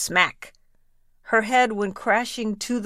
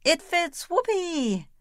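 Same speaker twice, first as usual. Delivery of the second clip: very high, gleeful squeal; the ugly sister shrieking with glee; triumphant scream; 8 semitones higher than usual. A narrator's reading. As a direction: crow it as if you've won the prize. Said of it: "But she screamed".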